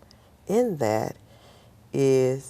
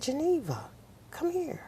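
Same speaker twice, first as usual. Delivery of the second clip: instructor calling her; 4 dB quieter than usual. Retold or imitated. Instructors voiced in story.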